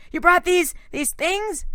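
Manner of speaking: high, shrieking question; horrified he led monsters in; panicked accusation. A narrator's reading. Nico panicking at the skeletons.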